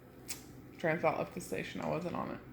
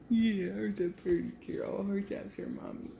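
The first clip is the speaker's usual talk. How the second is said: talking to a dog; 3 semitones higher than usual. Real, not acted. Cooing at dog.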